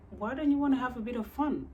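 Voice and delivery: high, quick imitation of coaxing; mock-pleading, rushed question. Retells date's pushy coaxing.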